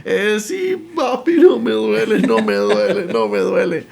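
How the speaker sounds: put-on high, loud whimpering child; hammed-up crying denial; mock tearful agreement. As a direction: imitate a boy bravely wailing through pain. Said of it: "del dolor que sentía".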